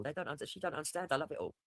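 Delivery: ordinary talk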